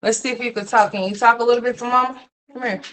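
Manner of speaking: high coaxing mom voice. Real, not acted. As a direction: coax the little one, high and sweet. Mom coaxing child to talk.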